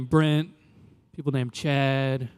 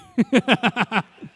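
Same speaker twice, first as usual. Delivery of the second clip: laugh; 6 semitones higher than usual. Real, not acted. Laughs at own joke.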